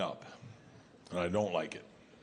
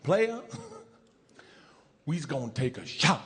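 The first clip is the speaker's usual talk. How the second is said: pimp drawl. Retold or imitated. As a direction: lean in close like a smooth pimp. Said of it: "that pimp drawl of his".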